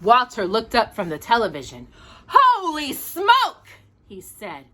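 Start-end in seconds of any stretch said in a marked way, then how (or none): 2.3-3.7 amazed. Acted. Walter hears rumbling roof.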